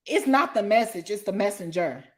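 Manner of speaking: ordinary delivery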